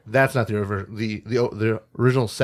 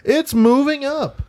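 singsong; 3 dB louder, slower than usual. Real, not acted. Singsong announcement.

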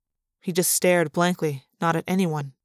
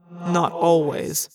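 slow, ominous reply; dark; much slower than usual. A narrator's reading. Alaran corrects Chapman about Andalite secrets, darkly. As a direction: draw it out grimly. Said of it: "Alaran said darkly".

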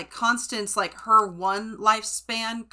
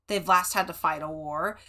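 ordinary talk

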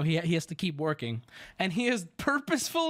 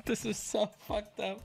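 real laugh breaking into softer words; 5 dB quieter than usual. Real, not acted. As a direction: crack up mid-story and talk through it. Cracks up at own story.

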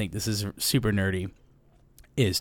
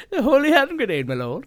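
Monty Python knight; 13 semitones higher than usual. Retold or imitated. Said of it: "Monty Python quote".

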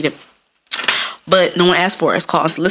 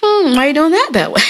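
fake-sweet probing; 9 semitones higher than usual. Teasing a coworker about their work, high and sugary. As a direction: coax it out, sugary. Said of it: "So I be like".

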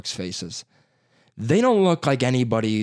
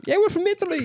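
squeaky mock Italian dude; faster than usual. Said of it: "They're like".

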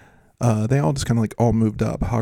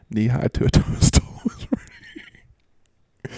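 laughing; much slower than usual. Real, not acted. Laughing at own joke.

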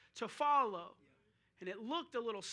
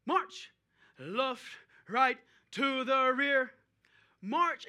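loud, drawn-out barked drill commands; loud marching commands, words stretched out; drill instructor call; 8 dB louder, slower than usual. Retold or imitated. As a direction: call it like a drill instructor across the parade ground. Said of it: "our drill instructor would call out".

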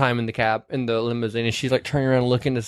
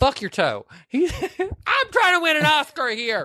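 high, slow overacted voice with a laugh; joking to friend about hurt toe, in melodramatic falsetto; mock Oscar bid. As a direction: play the hammy actor chasing an Oscar. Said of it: "bad acting".

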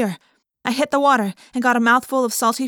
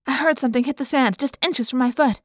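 ordinary delivery, nothing marked

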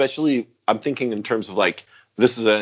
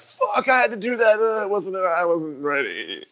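shaken and haunted; 7 semitones higher than usual. Retold or imitated. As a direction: let it drain out of you as it sinks in. Voicing the shaken actor after killing.

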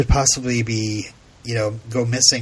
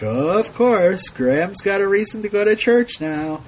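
sarcastic; 7 semitones higher than usual. Retold or imitated. Host mocking the plot twist, sarcastic. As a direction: roll your eyes at the plot. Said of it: "I'm like".

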